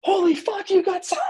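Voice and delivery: extremely high-pitched mock squeal; amazed excitement. Parents call back once the signing hit them, amazed excitement.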